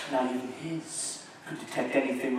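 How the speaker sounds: dropped to a sly near whisper; sly aside. A narrator's reading. Narrator gloating about the hidden eye, sly and soft. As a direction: tuck it away like a secret.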